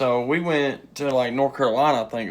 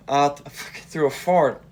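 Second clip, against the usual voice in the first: ordinary delivery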